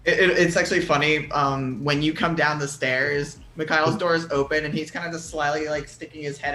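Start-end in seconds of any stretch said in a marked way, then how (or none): none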